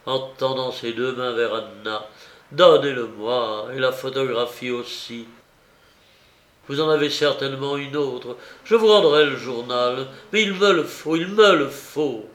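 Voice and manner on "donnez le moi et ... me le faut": desperate pleading. Acted. Aratov begging Anna for the diary, desperate loud plea. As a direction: demand it with desperate urgency. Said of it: "Dans sa supplication".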